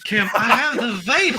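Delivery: high, hammed-up Southern lady swooning; faint dramatically with a Southern accent; mock Southern belle. Joking along with the hosts, in a mock Southern accent.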